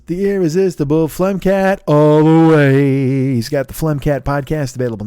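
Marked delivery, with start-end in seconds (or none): 0.1-3.3 announcer hype. Acted. Hyping the podcast name.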